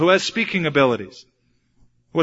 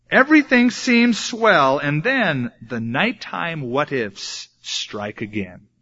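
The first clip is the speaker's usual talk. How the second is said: ordinary talk, nothing marked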